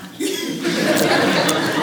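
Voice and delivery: a short airy laugh at the memory; breathy laugh. Real, not acted. Preacher laughing at his own story, airy laugh. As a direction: let a soft unvoiced laugh slip out.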